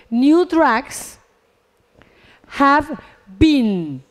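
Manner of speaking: word by word, with long pauses; spaced-out classroom dictation; deliberate example reading. Shown as a demonstration. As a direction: build the English sentence piece by piece. Teacher builds English passive sentence.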